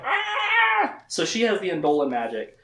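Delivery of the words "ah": mock cry out. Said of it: "he's like".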